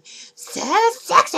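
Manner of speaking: very high, loud drawn-out squeal; piercing, stretched mock catcall; drunk girl's scream. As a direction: mimic a screaming drunk fan. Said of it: "that one girl kept going".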